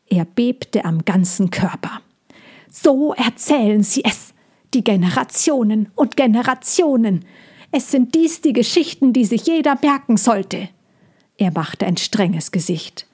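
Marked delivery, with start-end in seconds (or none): 2.7-10.7 higher bird voice, stern and solemn; the stern bird passing on the elders' tale. Acted. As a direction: preach it like ancient lore. Bird tells old tale.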